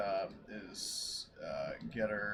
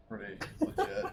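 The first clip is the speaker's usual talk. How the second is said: high laughing tag on the end; 11 semitones higher than usual. Real, not acted. Player laughing about a lucky kill, high laughing. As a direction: laugh at your own boast.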